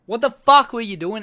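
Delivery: angry fan screaming at the fighter; furious shout. Acted. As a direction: bellow it in disbelief. Ranting at fighter's poor showing.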